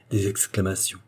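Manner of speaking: ordinary delivery, nothing marked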